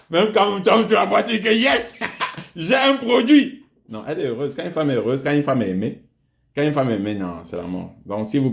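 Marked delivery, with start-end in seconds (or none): 0.1-3.6 high, loud thrilled exclamation; excited. Pretend. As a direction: gush with delight over her. Man thrilled seeing happy wife.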